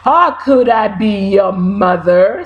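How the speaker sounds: a hint of a deep cow; low lazy cow answer; lightly voiced cow.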